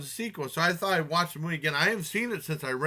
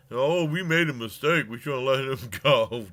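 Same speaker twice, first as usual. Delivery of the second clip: mock regret; 4 dB louder than usual. Mocking officials' regret in movie retelling.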